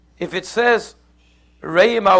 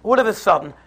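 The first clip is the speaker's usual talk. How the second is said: usual speaking voice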